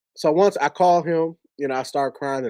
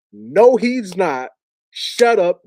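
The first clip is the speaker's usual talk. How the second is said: loud, raised-pitch retold refusal; explosive; 5 semitones higher, 6 dB louder, much slower than usual. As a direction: cut him off with an explosive mock outburst. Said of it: "At first, he was explosive".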